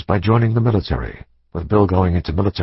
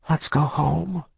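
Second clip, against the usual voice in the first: put-on higher and softer, a worn-out girl; tired teenage daughter; 8 semitones higher, 5 dB quieter, slower than usual. Daughter to her mother, wanting only home: tired and soft. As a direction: let it drain out of her, she's done.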